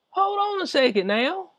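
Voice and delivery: mocking high-pitched hold-up. Repeats the phrase in disbelief.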